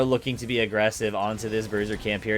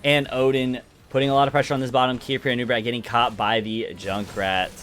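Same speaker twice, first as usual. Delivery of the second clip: ordinary delivery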